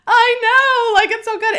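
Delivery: high, loud tearful exclamation; replying to her husband, high and loud with joy; overjoyed. Retold or imitated. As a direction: squeal through happy tears. Crying reply to Michael.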